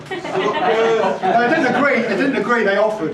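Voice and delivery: high, loud, amused protest; laughing correction. Real, not acted. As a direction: correct yourself through a laugh, voice jumping up. Laughing correction of own wording.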